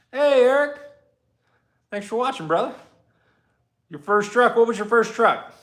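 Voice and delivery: high, loud greeting; calling out. Real, not acted. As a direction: call out to a viewer across the garage. Calling to commenter Eric.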